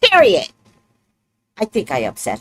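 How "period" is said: high, loud retold order; retold mad outburst. Telling a client who made her mad, retold angry outburst. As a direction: replay losing your temper, punch it out loud. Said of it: "She made me so mad".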